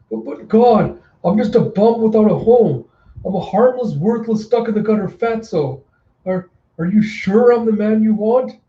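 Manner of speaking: high, stammering nervous plea; cowering wretch begging off; pleading bum. Acted. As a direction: grovel in a high voice.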